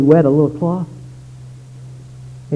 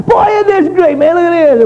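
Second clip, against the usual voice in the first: very high, loud mock excitement; drunken party glee; 5 dB louder than usual. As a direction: whoop it up like a partygoer showing off. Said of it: "Everybody's laughing".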